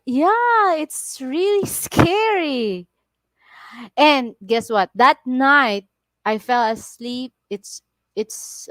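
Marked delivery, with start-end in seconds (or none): 0.1-2.9 emphatic dread. Real, not acted. Reliving a frightening near crash.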